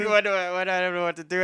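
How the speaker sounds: panicked confusion. Retold or imitated. Threatened, at a loss.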